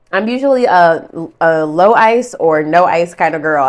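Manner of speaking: talking through leftover giggles; real amused laughter fading into speech; still laughing. Real, not acted. Still laughing about the ice.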